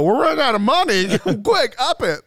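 high, panicky voice of a greedy boss; mock greedy executive. Friends mocking Disney bosses, high panicky exec voice.

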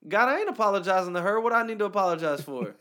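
high, loud refusal; indignant outburst. Retold or imitated. Telling a friend how he refused God, a loud high protest. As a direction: protest loudly that you won't apologize.

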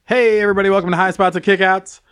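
upbeat host greeting; podcast host intro. Acted. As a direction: announce the show with energy.

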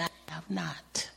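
breathy whispered aside. Real, not acted. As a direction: drop to a whisper. Quiet testimony of faith.

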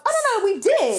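high, loud, comically insistent agreement; insistent concession. Retold or imitated. Client conceding the point to her therapist, insistent concession. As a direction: play up a hurried, high-pitched backtrack.

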